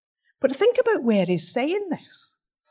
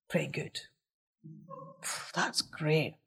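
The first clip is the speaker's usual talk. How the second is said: a quiet, musing aside under the breath; a private inner thought, barely voiced; murmured to himself; 6 semitones lower, 18 dB quieter than usual. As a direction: mull it over quietly, half to yourself.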